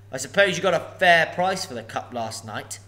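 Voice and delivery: a huge dragon toying with a thief; booming sly dragon; menacing dragon. Acted. Dragon speaks.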